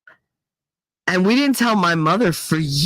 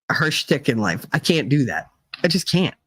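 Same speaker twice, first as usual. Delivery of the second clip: usual speaking voice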